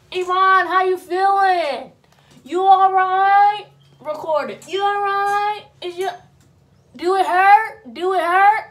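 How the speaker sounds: loud high nosy relatives; mock fussing aunties. Aunties crowding the hospital bed, loud high mock fussing. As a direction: fuss over her, loud and high, slow.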